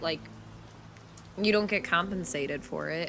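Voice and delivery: ordinary talk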